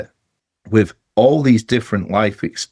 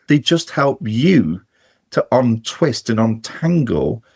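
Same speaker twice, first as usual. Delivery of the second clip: slow and deliberate; slower than usual. Real, not acted. Slowed, deliberate explanation.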